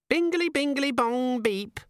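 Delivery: squeaky tiny gadget voice; giggly sneering imp. Acted. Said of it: "said a voice from Vimes's pocket".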